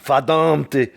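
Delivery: grandmother yelling in German. Retold or imitated. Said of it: "my grandmother would run around".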